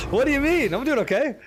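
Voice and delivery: high-pitched defensive comeback; amused protest. Real, not acted. Streamer defending his play to chat, amused protest. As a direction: protest it high, just after a laugh.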